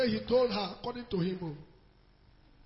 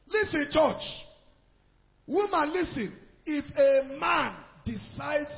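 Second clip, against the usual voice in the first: higher and louder, summoning attention; calling out; 4 semitones higher, 5 dB louder, slower than usual. Real, not acted. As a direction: call the church to listen, slow at the end. Preacher calls out to congregation.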